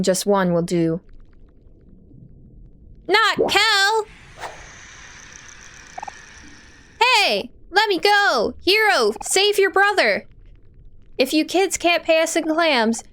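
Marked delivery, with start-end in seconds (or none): 3.1-10.3 high, frantic yelling; panicked cry for help. Acted. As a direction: scream for rescue. Captured character cries for help.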